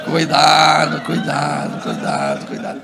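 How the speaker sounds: low, drawn-out warning sinking away; repeated caution in a low fading monotone. Acted.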